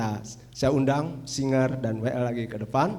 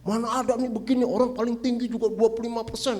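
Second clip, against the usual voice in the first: mock angry HR man, higher and louder; retold angry outburst; 8 semitones higher, 6 dB louder than usual. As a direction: act out the HR man's angry protest. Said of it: "Marah-marah".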